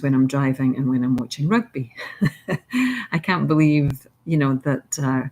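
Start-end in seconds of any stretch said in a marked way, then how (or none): 2.0-3.1 high amused laugh. Real, not acted. Laughing about road rage and rugby.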